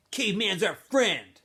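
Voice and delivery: lightly voiced tough guy standing up for a friend; Armpit's warning. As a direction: lean on each word to scare the bully. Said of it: "says Armpit".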